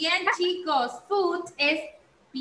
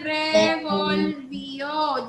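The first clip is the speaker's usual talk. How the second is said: revolvió drawn out slowly to a long final Ó; slow, singsong revolvió with stressed ending; much slower than usual. Shown as a demonstration. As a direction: stretch revolvió, landing on the last syllable "ó". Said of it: "Lleva acento, chicos, en la O".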